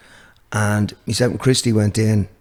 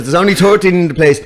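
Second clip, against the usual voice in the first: louder, lightly hammed-up Tipperary accent; 4 semitones higher, 5 dB louder than usual. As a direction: imitate a venue owner in Tipperary accent. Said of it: "That's a classic Tipperary there".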